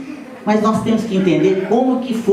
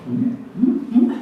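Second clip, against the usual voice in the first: mugugni d'intesa sommessi; 6 semitones higher, slower than usual. Retold or imitated. Child's silent hinting sounds to grandma.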